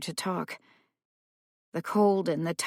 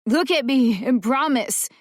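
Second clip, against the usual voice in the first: high, louder urgent demand; 6 semitones higher, 6 dB louder than usual. Acted. Boy demanding the paramedic promise, urgent plea.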